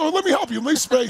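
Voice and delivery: imitating wife. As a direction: play her as cheerfully keen to help. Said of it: "So my wife said".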